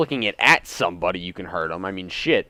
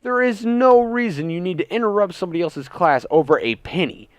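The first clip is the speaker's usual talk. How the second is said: livid outburst; 6 semitones higher than usual. Retold or imitated. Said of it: "I was like".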